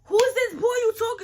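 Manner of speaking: loud, high, furious fatherly yell; angry dad yelling. Retold or imitated. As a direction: shout like a dad who just caught you. Dad confronting her about texts.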